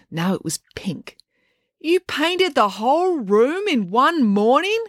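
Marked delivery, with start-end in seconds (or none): 1.8-4.8 high, slow, wavering incredulity; appalled disbelief. A narrator's reading. April discovering freshly painted walls, appalled disbelief. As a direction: stretch the question out, astonished.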